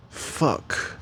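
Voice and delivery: under-the-breath whisper; whispered fuck on realizing; whispered curse. Real, not acted. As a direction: hiss it under your breath, caught out. Realizes he's naked on camera.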